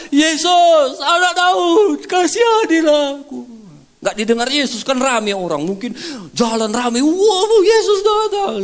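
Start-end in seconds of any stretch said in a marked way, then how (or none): 0.1-3.5 high, loud desperate shout to Jesus; a blind beggar crying out over the crowd. Acted. Blind Bartimaeus shouting to Jesus, high and loud. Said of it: "dia teriak". 7.0-8.6 excited crowd. Acted.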